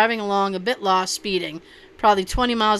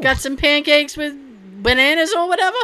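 high, lilting neighbor request; chirpy neighbor; 5 semitones higher than usual. Pretend. Neighbor asking for pancakes, chirpy. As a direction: pitch it up like a friendly neighbor.

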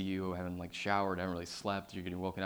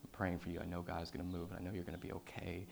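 soft, low, tender farewell; deep heartfelt; 3 semitones lower, 8 dB quieter than usual. Retold or imitated. Brother before surgery, softer tender goodbye. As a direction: speak softly as if it's the last words. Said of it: "really deep".

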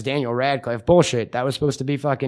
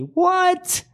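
mock surprise. Mock outburst in theme park tease.

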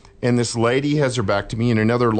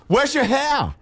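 very high, put-on Cockney woman; hammed-up Cockney questioning; mock Cockney; slower than usual. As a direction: do a bad English accent, way up high. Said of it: "she was real cockney".

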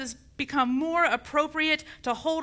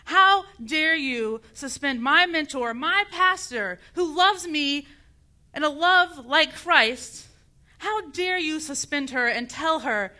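indignant preacher confronting a superior, drawn out; righteous outrage, stretching the key words; indignant confrontation. Acted. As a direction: challenge him to his face, slow and emphatic. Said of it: "look him in his face and say".

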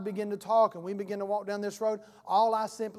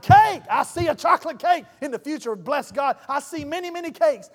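loud and higher, shouting visions; ecstatic prophet; 5 semitones higher, 11 dB louder than usual. Acted. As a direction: proclaim it like a prophet in full flight. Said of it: "The prophetic is like".

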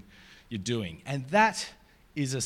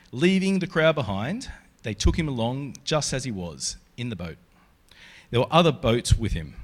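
a measured, louder Bible reading to the congregation; scripture reading; 4 dB louder than usual. Acted. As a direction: read it out to the room like a lesson. Reads scripture passage aloud.

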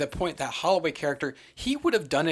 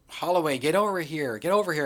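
higher, excited call to a colleague; mock excited; 5 semitones higher, 5 dB louder than usual. Scientist calling her partner to see the cave painting, mock excited. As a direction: imitate an excited summons, pitched up. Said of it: "runs out all excited and she's like".

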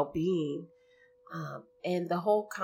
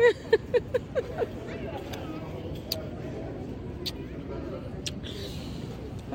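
long delighted laugh; joyful laughter. Real, not acted. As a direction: laugh with happy relief.

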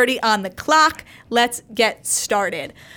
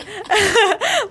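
high laughing fit; 10 semitones higher than usual. Real, not acted. Host cracks up mid-segment.

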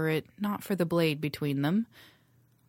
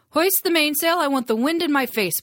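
shouted order; 10 semitones higher, 8 dB louder than usual. Acted. Captain shouting orders on deck.

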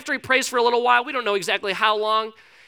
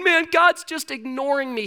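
higher-pitched frustrated grumble, acted out; mock frustration; 6 semitones higher than usual. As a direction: imitate someone fed up with God. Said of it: "getting all frustrated".

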